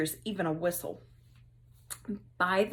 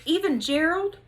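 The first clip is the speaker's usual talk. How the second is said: higher, louder blurt; 8 semitones higher, 7 dB louder than usual. Acted. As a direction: blurt it before you can stop. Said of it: "Somebody blurted".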